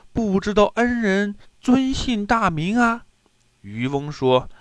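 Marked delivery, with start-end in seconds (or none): 0.1-3.0 high-pitched, courteous question; polite inquiry. A narrator's reading. Prince asking his rescuer's honourable name, high polite inquiry. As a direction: ask in a raised, deferential voice.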